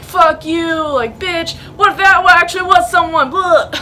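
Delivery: hammed-up angry bickering; mock argument. Scolding Nicholas for his fake jump scare, mock angry. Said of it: "fighting and, like, arguing".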